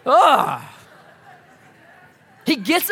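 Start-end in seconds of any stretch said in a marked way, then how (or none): 0.0-0.6 slow fed-up give-up. Retold or imitated. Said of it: "I'm like".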